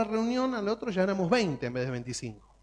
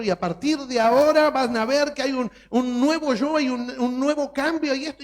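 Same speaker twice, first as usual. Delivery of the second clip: pitched up and loud, all fired up; mock eager vow; 8 semitones higher, 8 dB louder than usual. Hammed-up believer vowing a new self, loud and excited. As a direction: swear a brand-new self in a rush of zeal. Mocking rash promise to God.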